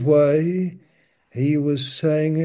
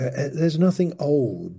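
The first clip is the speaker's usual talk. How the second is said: ordinary talk, nothing marked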